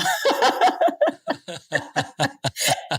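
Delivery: hearty burst of laughter; long, rolling laugh. Real, not acted. Laughing at own jollof joke.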